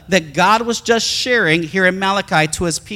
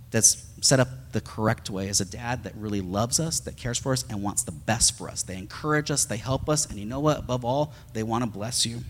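softer, lower, tender preaching; soft sincere preaching; 5 semitones lower, 8 dB quieter than usual. Acted. Pastor to congregation on loving fathers, soft and sincere. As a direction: speak softly of how a loving dad should be. Tender sermon about dads.

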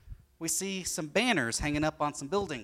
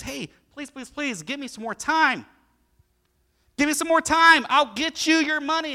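pleading friend; 12 semitones higher, 9 dB louder than usual. Acted. Friend begs for time.

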